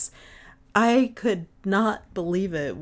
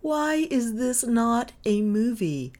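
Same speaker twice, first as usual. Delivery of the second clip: excited; 8 semitones higher, 4 dB louder, slower than usual. Real, not acted. Gushing over the book's ending.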